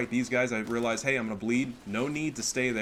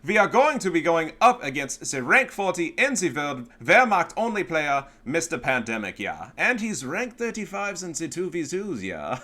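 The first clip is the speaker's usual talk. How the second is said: put-on loud, high German-accented show intro; hammed-up German announcer, stretching the big words; 7 semitones higher, 11 dB louder than usual. Host welcoming viewers to another cast, hammed-up German accent.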